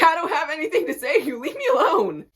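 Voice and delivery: loud, high retold snap; exasperated outburst. Player to pick-me teammate wanting advice, exasperated. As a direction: raise pitch and volume, rush into the brush-off.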